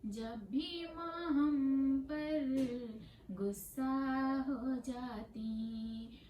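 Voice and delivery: lilting, half-sung verse with drawn-out endings; poem recitation. Acted. Poet reciting about beloved grandmothers, melodic recitation. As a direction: chant it tunefully, stretching the vowels.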